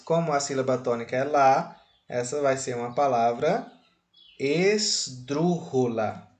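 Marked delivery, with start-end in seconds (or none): 4.4-6.3 "esdrúxula" slowly, in measured syllables; careful new term. Shown as a demonstration. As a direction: introduce "esdrúxula" slowly, stress on "drú". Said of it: "essa palavra esquisita aí".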